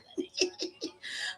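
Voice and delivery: breathy giggle. Real, not acted. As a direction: giggle at yourself. Laughs at own slurring.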